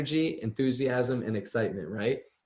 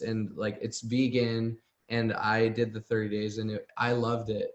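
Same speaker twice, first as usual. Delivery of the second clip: unenthusiastic; 2 semitones lower than usual. Shown as a demonstration. Coach demos a weak reach-out to a friend, unenthusiastic. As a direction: stumble through it with fillers, losing steam. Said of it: "when you have that energy and enthusiasm".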